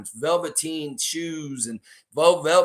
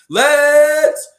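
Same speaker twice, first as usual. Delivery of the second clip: loud, high and slow stretched call; 12 semitones higher, 14 dB louder than usual. Real, not acted. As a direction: hail him across the room. Calling out to viewer.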